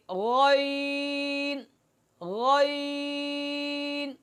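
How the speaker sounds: غین full-mouthed and thick, drawn out very slowly; correct ghain. Shown as a demonstration. Teacher demonstrating the correct غین to students. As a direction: fill the mouth with غین without nasality. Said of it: "اس طرح اس کو ادا کیا جائے گا".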